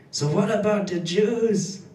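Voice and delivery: mock heckler's call, pitched well up. Audience member heckles comedian at a gig, high mock heckle. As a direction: imitate a heckler with a high, rising-and-falling call. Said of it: "someone heckled me".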